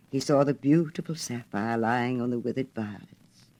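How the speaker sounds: usual speaking voice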